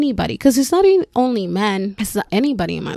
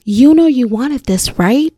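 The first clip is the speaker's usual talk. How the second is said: retold pushy line, a bit higher and louder; imitated pushy man; 4 semitones higher, 5 dB louder than usual. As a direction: lightly act out the guy pressing her. Quotes the guy who grabbed her.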